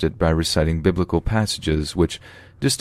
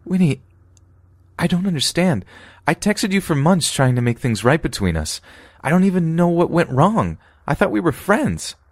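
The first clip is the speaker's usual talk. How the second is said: high, strained upset voice; hurt, near tears; 12 semitones higher than usual. Acted. Narrator confronting her best friend, hurt and tearful. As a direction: demand an answer, wounded.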